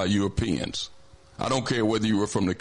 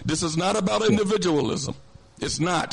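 raised insistent voice; 7 semitones higher than usual. Real, not acted. Heated call-in argument.

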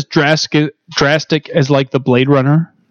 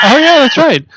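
high, loud laughing yelp; real laughing outburst; laughing shout; 11 semitones higher, 4 dB louder than usual. Real, not acted. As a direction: squeal it through laughter. Laughing at the joke.

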